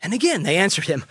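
ordinary talk, nothing marked

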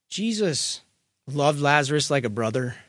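ordinary talk

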